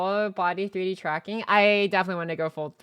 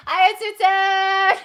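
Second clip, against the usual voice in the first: excited greeting; 11 semitones higher, 12 dB louder, much slower than usual. Real, not acted. Greeting a resubscriber on stream.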